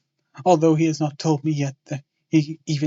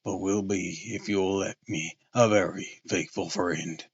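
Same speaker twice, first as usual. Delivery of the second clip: Quincey's voice, lower; earnest suitor voice; 5 semitones lower than usual. Acted. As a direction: lower voice for the hearty gentleman.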